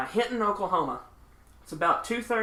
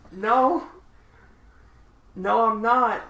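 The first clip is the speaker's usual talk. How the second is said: louder, higher mock-offended no; indignant denial; 5 semitones higher, 6 dB louder, slower than usual. Vocalist to woman who took her for hooker, indignant. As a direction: retell an affronted denial, pushed up and loud.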